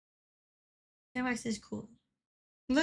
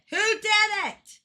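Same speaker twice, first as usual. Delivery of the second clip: higher, slow and loud; demanding; 9 semitones higher, 3 dB louder, much slower than usual. Real, not acted. Streamer demanding who did it, loud and slow. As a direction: demand an answer slowly. Repeats the question louder.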